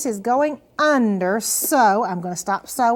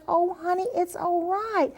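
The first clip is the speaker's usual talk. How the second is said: higher, gentle coaxing of a nervous student; reassuring; 5 semitones higher than usual. Retold or imitated. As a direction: soothe her like a scared child. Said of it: "I said".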